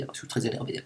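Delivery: "je suis très énervé" breathed out as a soft, toneless whisper; muttered under the breath. Pretend. Repeats his anger line twice.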